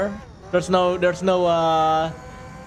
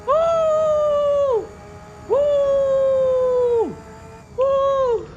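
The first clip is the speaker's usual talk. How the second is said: victory whoop; much slower than usual. Real, not acted. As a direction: cheer the win at the top of your voice. Victory lap celebration cheer.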